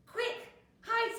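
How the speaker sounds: high, loud warning; urgent alarm. A narrator's reading. Fox rushing friends away from bear, alarmed. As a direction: order them to safety urgently.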